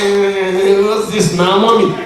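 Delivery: loud, put-on complaint to mum; imitated protesting son. Son protesting the forced hug to his mother, imitated complaint. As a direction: groan it out like an embarrassed boy.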